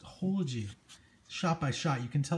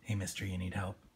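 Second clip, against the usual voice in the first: Clown's voice; 6 semitones lower, 6 dB quieter than usual. Retold or imitated. Reading Clown's line in comic.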